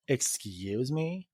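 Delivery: slow, soft sassy comeback. Retold or imitated. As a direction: drawl it out with attitude. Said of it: "I'll be like".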